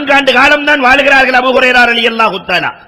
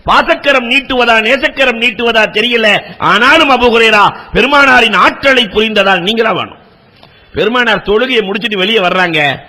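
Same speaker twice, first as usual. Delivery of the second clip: ordinary talk, nothing marked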